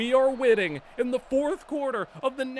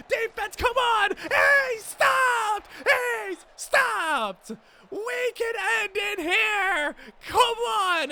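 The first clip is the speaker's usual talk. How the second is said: much higher and louder, begging the defense; urgent cheering; 10 semitones higher, 5 dB louder, slower than usual. Real, not acted. Player pleading with his defense on fourth down: desperate cheering. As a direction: shout them on.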